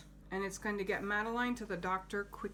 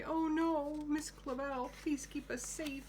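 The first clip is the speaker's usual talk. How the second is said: higher, mildly pleading; scared girls; 7 semitones higher than usual. Acted. Teacher voices the frightened girls pleading with Miss Clavel, higher. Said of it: "They say".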